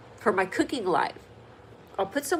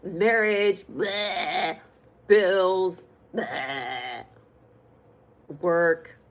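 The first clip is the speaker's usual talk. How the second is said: hammed-up griping with dropped, disgusted blehs; mock complaining; 4 dB louder, much slower than usual. Cooking host complains about life, playfully disgusted. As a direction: grumble through a list of pet peeves. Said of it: "I could just complain about things".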